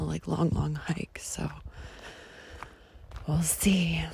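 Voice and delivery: trailing whisper. Real, not acted. Planning tomorrow's hike, trailing whisper.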